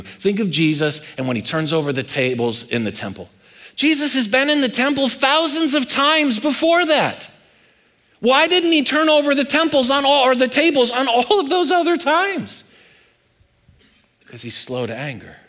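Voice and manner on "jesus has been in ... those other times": raised preaching pitch. Real, not acted. Preacher challenges the congregation, high-pitched questioning.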